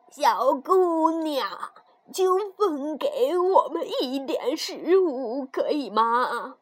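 very slow, quavering dwarf voice; pleading old dwarf. Acted. Dwarf asks rude girl to share food, slow quavering plea.

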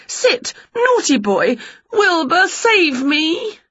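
high, lively witch voice; bright eager witch teasing her pets; Winnie's voice. A narrator's reading. Witch quizzing the dog and Wilbur, high and lively.

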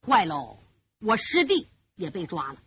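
low, sinking aside to himself; dismayed inner thought. A narrator's reading. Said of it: "心里明白呀".

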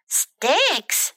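mildly disbelieving one-word question; storybook bird taken aback; incredulous. Acted. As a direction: balk at the very idea. Bird balks at being offered sticks.

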